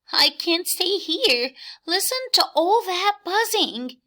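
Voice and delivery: high, strained bug voice; high bug voice, a touch distressed; upset bug. Acted. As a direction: cry it out, rattled by the noise. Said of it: "cries the bug".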